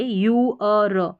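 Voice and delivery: यू अ र drawn out slowly in three parts; sound by sound. Shown as a demonstration. Teacher breaking the sound up for learners, slow segmented यू अ र. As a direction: stretch यू, then अ, then a separate र. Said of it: "अब इसको यूअर ऐसे बोलेंगे".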